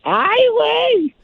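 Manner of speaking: high, slow drawn-out "Ay, güey"; put-on voice. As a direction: stretch it out in a silly high voice. Recurring put-on catchphrase voice.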